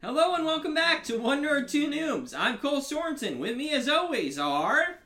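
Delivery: high, loud host welcome; show-opening announcer. Acted. Host welcoming listeners back to One Nerd, loud announcer. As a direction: belt out the podcast intro.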